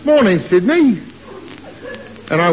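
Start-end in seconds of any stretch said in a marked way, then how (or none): 0.0-1.0 mock sun greeting. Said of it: "as if to say".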